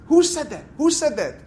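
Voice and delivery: high, loud demanding shout; angry yelling. Retold or imitated. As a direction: bark out the challenge, furious. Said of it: "starts yelling and screaming at my Rebbe".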